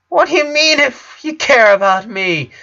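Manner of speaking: high, loud indignant outburst; angry. A narrator's reading. Sister outraged at her brother's words, angry. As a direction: throw his words back at him. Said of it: "looking angry".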